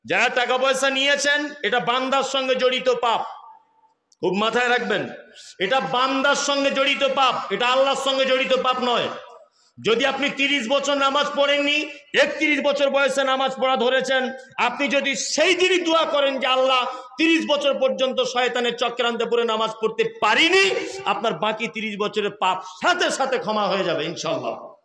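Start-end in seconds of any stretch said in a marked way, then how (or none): 0.0-9.1 raised preaching voice. Real, not acted. 9.8-20.7 full-force preaching. Real, not acted.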